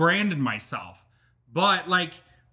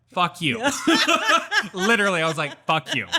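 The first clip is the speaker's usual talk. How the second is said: laughing, louder defiant retort; laughing mock defiance; 3 semitones higher, 5 dB louder than usual. Telling the teleprompter off, laughing and louder.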